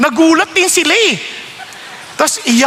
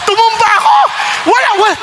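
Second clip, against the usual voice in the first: very high, loud cry of shock; sudden cry; 9 semitones higher, 4 dB louder than usual. Retold or imitated. Retelling being knocked down by prayer, shocked cry. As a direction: yelp as the body falls. Falling under the preacher's touch.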